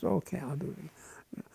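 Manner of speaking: muttered under the breath; whispered agreement. Retold or imitated. Examiner grudgingly agreeing to the retest, whispered. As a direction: say it off to the side, barely voiced.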